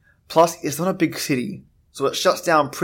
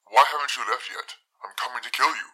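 menacing caller voice; 5 dB quieter, faster than usual. Acted. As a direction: menace them from the other end of the line. Threatening caller on the phone.

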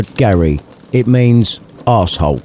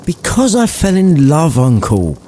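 put-on higher, sincere young voice; earnest nephew; 8 semitones higher than usual. Fred telling his uncle he fell in love, higher. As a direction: say it simply and earnestly.

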